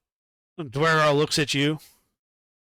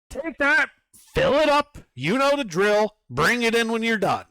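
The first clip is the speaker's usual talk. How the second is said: higher bossy overseer voice; Duergar foreman calling out; 9 semitones higher, 3 dB louder than usual. Acted. Duergar foreman calls out to Hebron, higher and bossy. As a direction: hail the new worker like a pushy dwarf boss. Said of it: "And he calls out".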